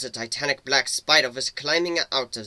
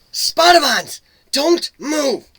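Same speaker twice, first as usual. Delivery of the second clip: high, loud urgent warning; alarmed shout; 12 semitones higher, 9 dB louder, much slower than usual. A narrator's reading. Warning him of trap vines.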